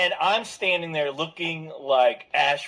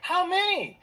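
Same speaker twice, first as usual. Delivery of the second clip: "How many?" pitched well up, mock clerk; high clerk voice; 11 semitones higher than usual. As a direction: play the ticket clerk high and light. Retold ticket clerk quote.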